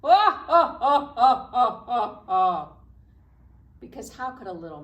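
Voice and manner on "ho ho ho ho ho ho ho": big lion laugh. Shown as a demonstration. Librarian leads the children in a booming lion laugh. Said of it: "Can you make a big lion laugh?".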